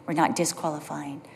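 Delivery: whisper. Real, not acted. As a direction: breathe it out as a whisper. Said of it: "whispered repeat".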